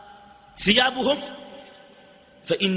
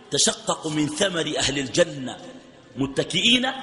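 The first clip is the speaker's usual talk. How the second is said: normal talking voice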